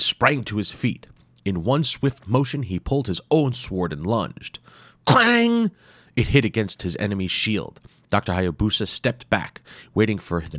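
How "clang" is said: high loud ringing clang. A narrator's reading. Narrator voicing sword on shield. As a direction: ring out like metal. Sound effect in story.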